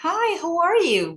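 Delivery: "Hi, how are you?" starting high and loud, then dropping; singsong "Hi, how are you?", high start gliding down; English up-and-down intonation. Shown as a demonstration. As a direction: greet with a big melodic swoop on "Hi, how are you?". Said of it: "ada logatnya ketika ngomong naik turun".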